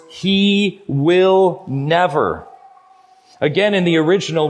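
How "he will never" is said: "He will never" drawn out very slowly, each word set apart; slow, weighty stress on every word of "He will never"; word-by-word emphasis. Shown as a demonstration. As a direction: stretch and separate HE, WILL, NEVER. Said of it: "look at those words".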